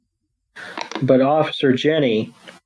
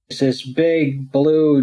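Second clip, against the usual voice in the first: ordinary delivery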